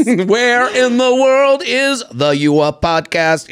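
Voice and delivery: hammed-up morning show host; playful morning TV promo voice; mock TV announcer. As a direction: announce it like a TV segment, slowly. Mock Today Show announcer bit.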